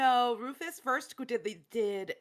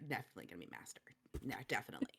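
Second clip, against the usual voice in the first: hushed, hopeful whisper; 7 semitones lower, 9 dB quieter, much faster than usual. Acted. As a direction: breathe it like a secret. Dog hopes master returns.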